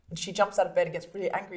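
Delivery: ordinary talk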